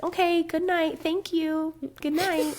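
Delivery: high, chirpy awkward brush-off; mock awkward. Heroine awkwardly dodging his love, in a high chirpy voice. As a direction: chirp it out to escape. Mocking Feyre's awkward reply.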